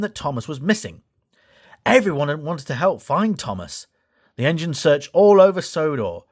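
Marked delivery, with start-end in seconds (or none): none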